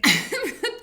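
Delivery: loud high laugh bursting out; high burst of laughter. Real, not acted. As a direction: crack up at the word dynasty. Laughing at "Fonz dynasty.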